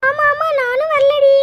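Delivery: slow, high mock-frightened refusal; scared backing out. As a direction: beg off slowly in a put-on fright. Women refuse to join the fight. (பயந்த மாதிரி மெதுவா பின்வாங்குதல்)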